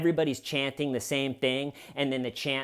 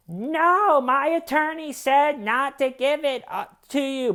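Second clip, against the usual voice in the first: very high, louder imitation of a protest; mock protest; 14 semitones higher, 4 dB louder than usual. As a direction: imitate her indignant refusal, voice pitched way up. Donna protests as phone is grabbed.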